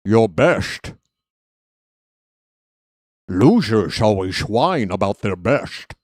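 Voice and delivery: slushy Connery s sounds, Scottish burr; Sean Connery impression. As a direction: lean into the Connery slur. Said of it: "Sean Connery impre".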